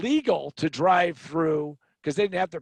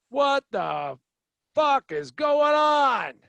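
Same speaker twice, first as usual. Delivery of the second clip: slow, high, loud mock yell; sarcastically "mellow" outrage; 8 semitones higher, 5 dB louder, much slower than usual. Angry passengers learn they are back in Newark, mock outrage. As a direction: stretch it out like an irate East Coast passenger. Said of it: "in their mellow, zen, Dalai Lama".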